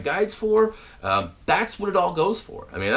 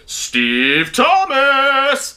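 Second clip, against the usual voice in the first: slow, much higher, loud called-out name; shout-out; 10 semitones higher, 6 dB louder, much slower than usual. Real, not acted. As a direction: call the name out across the room. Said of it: "doing the old hand funnel".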